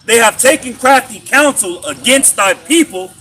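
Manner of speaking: loud, slow, emphatic scripture recital; scripture reading. Acted. Reading the Psalm out to the group, loud scripture recital. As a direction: proclaim each phrase of the verse.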